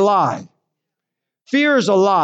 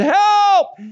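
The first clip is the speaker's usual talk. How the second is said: calling for help; 4 dB louder than usual. Retold or imitated. Stranded boater calls for help.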